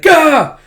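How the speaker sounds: very high, loud yelp; startled gah. Retold or imitated. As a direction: yelp it in shock. Retold manga, boy startled.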